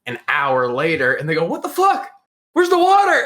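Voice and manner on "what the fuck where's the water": high mock yell of disbelief; exasperated demand. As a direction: imitate friends demanding an answer, pitched way up. Friends demanding the water, acted.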